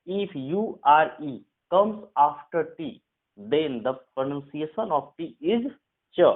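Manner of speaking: slow, deliberate rule dictation. Shown as a demonstration. Teacher to Hindi students, dictating the rule slowly. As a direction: spell out the rule word by word for students.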